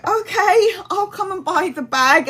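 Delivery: high, gullible mock-cheerful voice; naive yes. Imagining naive self buying bag.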